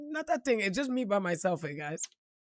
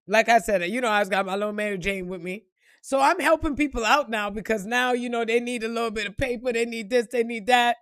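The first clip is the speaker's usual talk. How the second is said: ordinary talk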